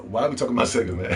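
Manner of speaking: usual speaking voice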